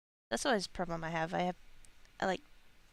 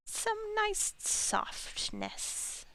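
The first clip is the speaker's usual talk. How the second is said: silly squeaky voice; 14 semitones higher, much slower than usual. Pretend. Silly high drawn-out voice.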